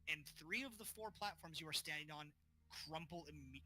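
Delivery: ordinary talk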